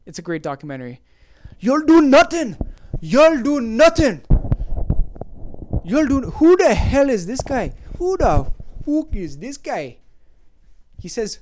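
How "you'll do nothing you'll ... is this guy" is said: high, exaggerated Irish fighter impression; Irish accent. Podcaster hamming up a fighter from a documentary, mock Irish accent. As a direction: put on a drawn-out, badly faked Irish accent. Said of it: "That's my Irish accent".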